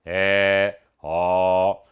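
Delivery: É and ó held long as open vowels. Shown as a demonstration. Teacher demonstrating open vowels to students, open and held long. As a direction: sustain open É and ó, low and level. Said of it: "é aberta".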